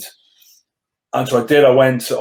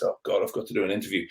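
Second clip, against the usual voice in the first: softer and fast, a worried inner voice; quiet quick dread; 5 semitones lower, 10 dB quieter, faster than usual. Retold or imitated. Waking on interview day, fast quiet dread. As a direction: rush the worry out under your breath. Retold anxious morning thought.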